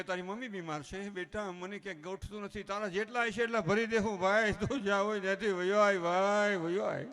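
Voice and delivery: building into a loud plea; missing-person TV appeal. Acted. Parents begging runaway son on TV, mock appeal growing loud. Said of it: "T વીમાં line દેવી પડે".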